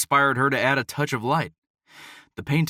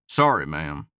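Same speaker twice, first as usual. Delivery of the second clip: lower, Southern drawl apology; a lightly voiced Southern cowboy; 6 semitones lower, 3 dB louder than usual. Hunter apologizes to her in a Southern drawl. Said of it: "he answered in his southern drawl".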